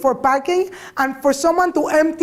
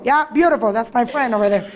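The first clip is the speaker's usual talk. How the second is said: ordinary talk, nothing marked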